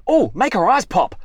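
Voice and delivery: loud, brash comedian voice; Rodney Rude impression. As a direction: belt it out crude and cheeky. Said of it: "That's Rodney Rude".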